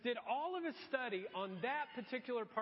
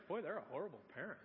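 judgmental aside; 5 semitones lower, 5 dB quieter, much faster than usual. Retold or imitated. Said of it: "every parent there is like".

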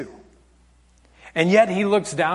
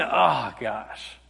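sinking, quieter "oh no" moment; deflated realisation; 7 semitones lower, 7 dB quieter than usual. Acted. Preacher realising he talks too much himself, deflated. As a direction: drop low and soft, caught out. Sudden realisation of own fault.

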